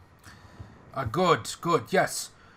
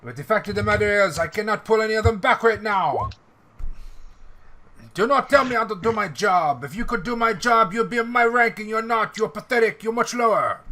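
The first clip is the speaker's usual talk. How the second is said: raised, higher voice, arguing hard; heated argument; 6 semitones higher, 4 dB louder than usual. Acted. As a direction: snap at him like a proud officer. NPC Louis arguing heatedly.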